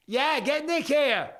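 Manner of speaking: slow, loud, high shout; drawn-out yell to someone far off; calling out. Real, not acted. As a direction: holler an order through the house. Shouting to have Nick brought.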